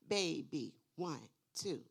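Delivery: put-on loud Baby, each syllable stamped; stomped syllables. Shown as a demonstration. Teacher making syllable stomping fun, loud. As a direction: stomp out Baby loudly for the kids. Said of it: "stomp it out".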